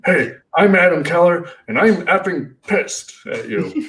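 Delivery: high, slowed, hammed-up indignant intro; mock angry greeting. Fans quoting his angry line back at him. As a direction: ham up being pissed, tongue in cheek. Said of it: "people still will say to me".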